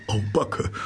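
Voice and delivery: high panting "Au Backe" with a rising end; the mole gasps with delight at each new find; excited gasp. A narrator's reading. Mole panting with delight over the picnic, high and rising. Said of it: "und keuchte".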